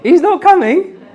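laughing, high, loud disbelief; a hammed-up gasp of shock; mock surprise. Teacher playing shocked at the role-play twist, laughing.

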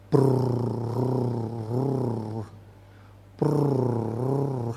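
low rolling purr; cat purr. Shown as a demonstration. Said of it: "I don't know if I can make it with the microphone".

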